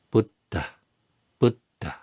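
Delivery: Buddha with a puff of air on the dh; aspirated Buddha. Shown as a demonstration. Teacher modelling Pali Buddha, aspirated. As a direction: breathe on the dh in Buddha. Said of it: "So we say".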